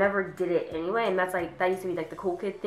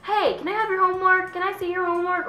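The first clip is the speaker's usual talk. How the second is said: high-pitched, pestering plea; mock wheedling classmate; 11 semitones higher than usual. Lazy classmate asking for homework, high mock pleading. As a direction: imitate a moocher in a put-on high voice.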